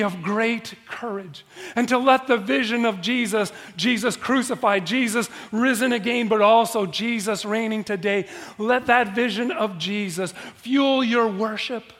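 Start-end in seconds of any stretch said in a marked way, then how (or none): none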